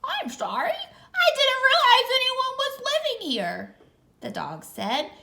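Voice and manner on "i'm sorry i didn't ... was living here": high laughing dog voice; sheepish high dog laugh; apologetic dog. Acted. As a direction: giggle out an apology, much higher.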